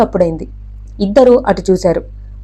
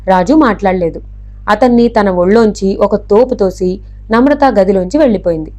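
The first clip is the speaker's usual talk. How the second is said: plain reading voice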